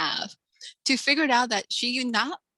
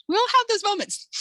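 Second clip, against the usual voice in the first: pitched high up, loud and bright, with a squeal on "have"; high emphatic quote; 12 semitones higher, 4 dB louder than usual. Retold or imitated. Author replying to readers with a high exclaim. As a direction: throw "We all have those moments" up high and loud. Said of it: "I was like".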